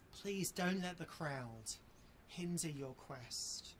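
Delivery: soft, slow, earnest appeal; slow down and plead softly; quiet slow plea. Real, not acted. Preacher begging hearers not to delay, softly and slowly.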